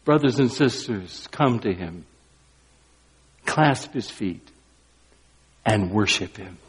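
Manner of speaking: tender invitation. Real, not acted. Preacher inviting the congregation to worship, soft and tender. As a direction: draw them in quietly.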